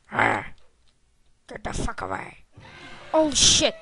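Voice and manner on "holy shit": loud, shocked outburst; startled curse. Real, not acted. Gamer blurts a loud shocked curse at a scare.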